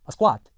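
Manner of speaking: SQUAT with an unrounded "ah": skwaht; American open vowel. Shown as a demonstration. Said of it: "일반 미국 영어에서는요".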